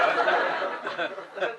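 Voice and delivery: ordinary delivery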